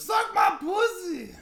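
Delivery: very high, loud, slow yell; mock scream. Friend retelling what he heard over curry, screamed imitation. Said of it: "all I heard was".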